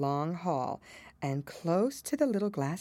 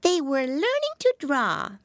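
high drowsy mouse voice; yawning little dormouse; sleepy dormouse; 11 semitones higher, 5 dB louder than usual. A narrator's reading. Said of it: "yawning and rubbing its eyes".

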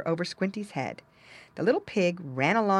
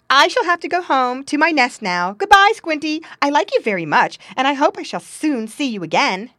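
high, loud squirrel goodbye; lively, raised squirrel voice; friendly girl squirrel; 9 semitones higher, 10 dB louder than usual. Acted. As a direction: bid an eager farewell as a little squirrel.

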